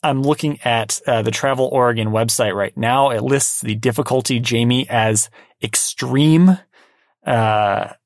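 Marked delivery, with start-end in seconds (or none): 5.6-6.6 "extreme" drawn out slow and punched; emphatic stress. Real, not acted. Telling Jamie the listed difficulty, emphatic stress on "extreme". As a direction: lean hard and long on "extreme". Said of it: "say it with that emphasis".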